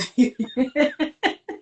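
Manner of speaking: light, drawn-out laugh. Real, not acted. Guest amused by her own Uganda saying, laughing. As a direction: laugh at your own remark.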